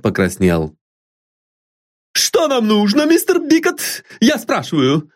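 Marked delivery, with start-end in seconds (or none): 2.2-5.1 very high, doting servant cooing fondly; an old servant fussing over her mistress; high, fond, bustling maid voice; fond exclaim from old maid. A narrator's reading.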